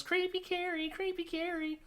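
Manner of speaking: put-on very high-pitched taunting kid; mock yell. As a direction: imitate a kid's jeering yell, way up high. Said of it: "gets right up in her face and yells".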